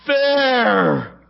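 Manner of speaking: put-on high, childlike voice of a crying son; a father retelling his upset son's words in a high child's voice; upset little boy. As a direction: voice the hurt five-year-old.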